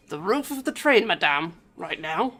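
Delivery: put-on puzzled French-accented detective, slowing down; French gentleman. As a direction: question it in a courtly French accent. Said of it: "a portly man with a dapper gray suit".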